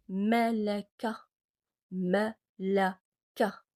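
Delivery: malaka drawn out very slowly; slow Arabic word. Shown as a demonstration. Teacher modelling final-kaf word slowly for class. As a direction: stretch each syllable of malaka for learners. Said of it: "Le kaf est à la fin de mot".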